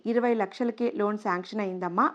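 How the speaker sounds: ordinary delivery, nothing marked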